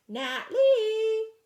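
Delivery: high sung-out call. Acted. Ma calls for her lost daughter, long high sung call. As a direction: call the name long and loud. Voice calling from afar.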